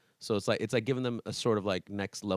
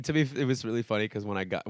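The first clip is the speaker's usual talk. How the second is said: normal talking voice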